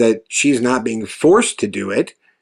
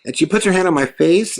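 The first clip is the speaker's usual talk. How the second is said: ordinary delivery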